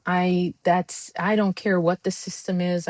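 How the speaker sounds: ordinary talk, nothing marked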